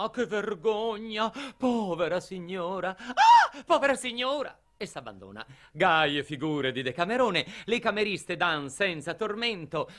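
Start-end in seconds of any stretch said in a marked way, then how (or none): 0.0-3.0 high-pitched theatrical pleading; acted maid's high lament; imploring maid. 3.0-4.6 very high cry, then pleading; hammed-up maid crying out at peak pitch; imploring maid's outcry. 4.8-5.4 sudden drop to a low, soft voice; soft, low narrated aside; hushed surrender. A narrator's reading. Narrator describes the maid yielding.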